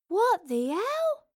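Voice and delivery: high, slow, stunned disbelief; drawn-out shocked gasp of words; disbelieving. Acted. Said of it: "came a disbelieving voice".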